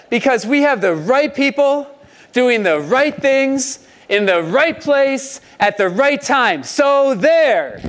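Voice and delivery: high, self-satisfied retort; a hint of smug boasting. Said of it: "they might have said".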